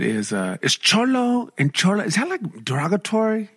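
high-pitched imitation Filipino accent; tentative, Filipino-accented high voice. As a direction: mimic a Filipino lady asking a delicate question. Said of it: "She got the accent still".

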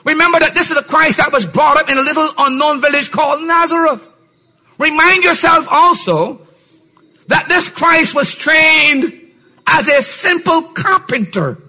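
full-force preaching. Acted. Preacher lifting the hall, high and forceful. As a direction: preach it high and strong to the congregation.

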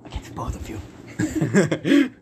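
whispered villain plot; conspiratorial whisper. Acted. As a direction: hush it like a secret plan. Whispered scheming aside.

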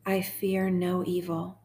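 slow, measured psalm reading; guided meditation. Real, not acted. Host guiding sad listeners through Psalm twenty-three, slow meditation. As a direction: read each phrase slowly, leaving room to repeat it.

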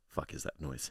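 whispered. Retold or imitated. Retold startled thought.

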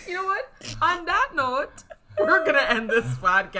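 loud laughing sign-off. Real, not acted. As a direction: say it through laughter.

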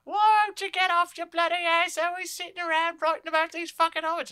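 very high, loud, screeching nag; hammed-up high-pitched scolding wife; nagging wife. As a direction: shriek like a fed-up wife in a comic spat. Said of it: "his wife fighting, where she's like".

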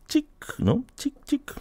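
camera click. Retold or imitated.

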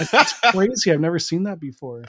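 high, amazed fake-American gush; teasing friends, faking astonishment as a clueless American visitor; mock wide-eyed tourist. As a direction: play a gullible foreigner marveling at traffic lights. Mocking a naive American tourist.

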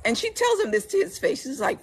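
usual speaking voice

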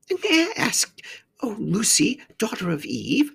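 high-pitched, tentative faun voice; courteous faun. A narrator's reading. Faun asking the girl a favour, high and courteous.

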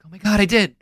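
high-pitched imitation of someone freaking out; Leo. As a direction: act out an excited, high freak-out. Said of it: "Leo freaks out".

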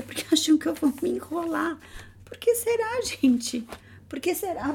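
squeaky high mock-offended voice. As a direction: feign hurt, way up high.

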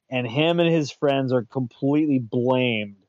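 ordinary talk, nothing marked

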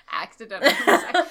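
loud, high laughing repeat. Real, not acted. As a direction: blurt it out laughing.

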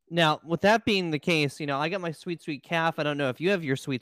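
ordinary talk, nothing marked

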